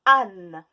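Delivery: âne lancé très fort; mot appuyé. Shown as a demonstration. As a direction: claironner le mot pour la classe. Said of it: "Dans le mot". (mot crié)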